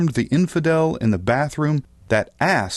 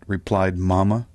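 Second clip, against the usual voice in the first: plain reading voice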